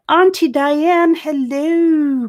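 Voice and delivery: high, loud, stretched greeting; calling out hello. Real, not acted. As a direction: hail an auntie across the room.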